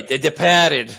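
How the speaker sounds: The Departed with a Boston "Depahted"; Boston accent. Retold or imitated. Correcting himself to the Boston pronunciation of The Departed. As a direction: say The Departed Boston-style, dropping the r. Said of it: "Oh, I'm sorry".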